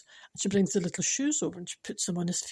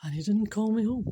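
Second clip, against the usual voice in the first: ordinary delivery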